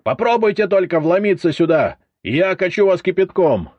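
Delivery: high, loud angry threat; threatening. A narrator's reading. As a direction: warn them off fiercely. Cook threatens through door. (гневная угроза)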